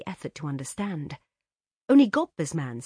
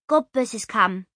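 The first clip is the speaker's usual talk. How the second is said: louder maid's announcement; announced; 6 semitones higher, 9 dB louder than usual. A narrator's reading. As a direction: call it out to the kitchen. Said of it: "announced Sadie".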